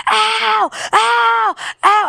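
high, drawn-out mock yelps of pain; imitated pain cries. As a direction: cry out high, re-enacting the pain. Imitates own cries during deliverance.

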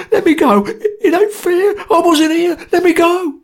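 very high, loud panicked pleading; gasping desperation. A narrator's reading. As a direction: squeal to be let go, desperate. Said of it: "he gasped".

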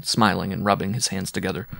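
ordinary delivery, nothing marked

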